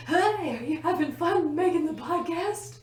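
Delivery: high, loud mock-cheery barging in; playful high-pitched heckle; intruding roommate. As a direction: call out like a nosy roommate crashing the recording. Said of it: "just walk in and go like".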